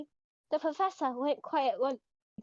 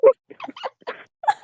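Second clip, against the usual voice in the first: high shrieking laugh; 9 dB louder than usual. Real, not acted.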